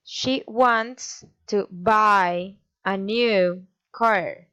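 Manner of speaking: four slow beats on WANTS, BUY, NEW, CAR; slow teacher's rhythm hitting WANTS, BUY, NEW, CAR; four stresses. Shown as a demonstration. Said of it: "A statement with four stresses".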